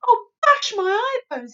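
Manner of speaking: very loud high outcry; distressed cry. A narrator's reading. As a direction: bellow it in distress. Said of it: "he cried".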